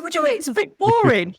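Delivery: incredulous retort. Retold or imitated. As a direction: retell it as an astonished, rushed comeback. Acts out her incredulous reply to mum.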